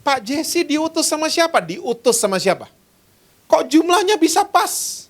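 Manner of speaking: retold tearful questioning, pitched mostly high; high-pitched mock-tearful disbelief; tearful, astonished neighbour. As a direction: imitate an overwhelmed neighbour asking through tears. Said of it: "dia nangis".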